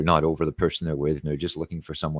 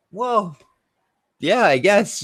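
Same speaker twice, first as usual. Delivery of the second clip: high, sheepish "well, yeah, I guess"; sheepish agreement; 6 dB louder, slower than usual. Retold or imitated. Answering his buddy's crude joke with a high sheepish shrug. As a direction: squeak out a reluctant agreement.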